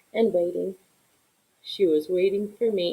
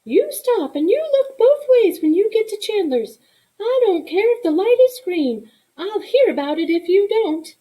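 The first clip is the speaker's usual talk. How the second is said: much higher, louder stern mother voice; mother's warning; 11 semitones higher, 5 dB louder than usual. Acted. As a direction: warn the child firmly, like a strict mother. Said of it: "My mother always said".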